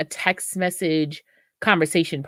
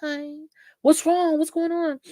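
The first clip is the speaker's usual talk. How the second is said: high, sweet concerned friend; caring friend voice; 12 semitones higher than usual. Retold or imitated. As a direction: coo at your sad friend like a caring girlfriend. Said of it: "and they be like".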